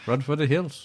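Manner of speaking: playful, badly faked Scottish accent; mock Scottish accent. Host clowns after his co-host's remark, mock Scottish accent. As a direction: ham up a Scottish accent for laughs. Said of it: "Scottish, man".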